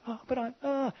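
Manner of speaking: high, soft, faltering excuse; hesitant objection. Acted. Prophet making excuses to God, high hesitant protest. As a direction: stammer a small protest and give up. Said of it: "And he goes".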